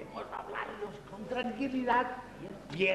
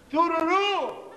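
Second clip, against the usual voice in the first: mocking; 6 semitones higher, 8 dB louder, slower than usual. Burla incrédula ante el secreto.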